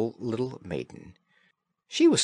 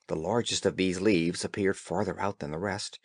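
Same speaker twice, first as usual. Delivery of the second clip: ordinary delivery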